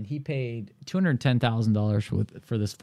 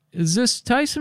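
voicing the client; 13 semitones higher than usual. Client voiced on phone.

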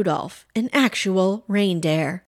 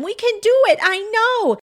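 high, straining rallying cry; shouted encouragement; 13 semitones higher, faster than usual. A narrator's reading. Pam urging Rudy as the rope pulls tight, shouted. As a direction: cheer the team on, pitched way up. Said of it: "shouted Pam".

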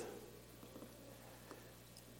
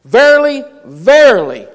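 forceful proclamation; 5 semitones higher, 8 dB louder than usual. Acted. Rereading verse with force.

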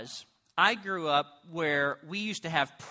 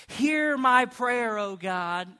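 raised, drawn-out solemn invocation; slow, higher-pitched formal praying; prayer voice; 4 semitones higher, 3 dB louder, slower than usual. Acted. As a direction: intone it slowly, calling up to God. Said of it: "That's his prayer voice".

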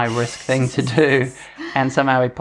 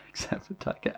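soft, voiceless echo; whispered echo; 14 dB quieter than usual. Retold or imitated. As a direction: whisper it back. Whispering the exception back.

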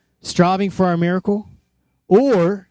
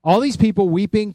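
ordinary talk, nothing marked

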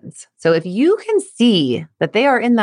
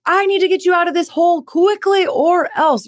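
urgent pushy parent; 11 semitones higher than usual. Pretend. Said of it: "where you're not like".

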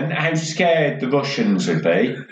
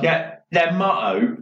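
ordinary talk, nothing marked

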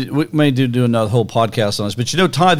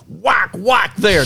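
slow, drawn-out mock whack, higher; whack sound effect; 6 semitones higher, much slower than usual. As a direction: stretch out each whack like hitting the rock. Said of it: "I like your sound effects on the whack".

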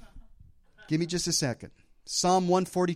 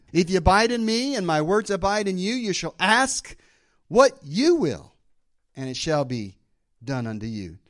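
preaching; 3 semitones higher, 5 dB louder than usual. Acted. Preaches the verse at full force.

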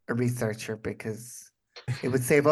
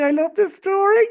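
squealing "I love this story" in a much higher, louder voice; excited squeal; 7 dB louder than usual. Real, not acted. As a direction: gush with delight. Gushing over wedding news.

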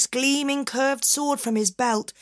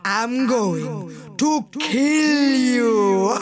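menacing genie; slower than usual. Acted. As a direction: threaten like a giant.

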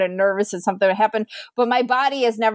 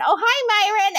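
higher, knowing mock-sweet mom; 9 semitones higher than usual. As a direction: mom greeting them as if nothing happened. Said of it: "his name was Myron".

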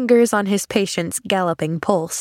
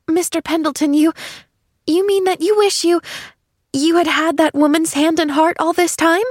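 put-on high, halting child's voice, full of wonder; eager little girl; 9 semitones higher than usual. A narrator's reading. Girl asking Mister Pendleton about his wish, eager and high. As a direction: voice an eager girl piecing it together.

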